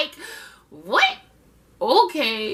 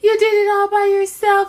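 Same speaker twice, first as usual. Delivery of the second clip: high, loud mock disbelief; sarcastic Angela; 9 semitones higher, 8 dB louder than usual. Angela mocking Tony's boast, high loud sarcasm. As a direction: drip sarcasm, act impressed. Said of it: "And she was like".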